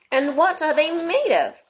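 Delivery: high-pitched, eager question; great curiosity. A narrator's reading. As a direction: ask it full of curiosity. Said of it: "in a tone of great curiosity".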